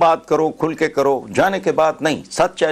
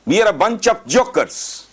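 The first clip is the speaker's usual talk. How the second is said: slow bitter jab; 7 semitones higher, much slower than usual. Real, not acted. Bitter summing up.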